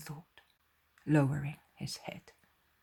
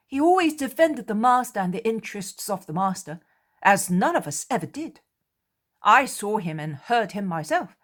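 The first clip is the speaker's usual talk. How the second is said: louder and higher, insisting; defensive apostle; 6 semitones higher, 10 dB louder than usual. Acted. Thomas defending Judas to the others, louder.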